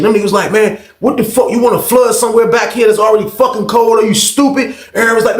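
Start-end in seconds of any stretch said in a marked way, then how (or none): 0.5-4.7 higher, exasperated telling-off; scolding. Retold or imitated. Guard berating inmate for flooding, exasperated scolding. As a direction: imitate a guard fed up and scolding.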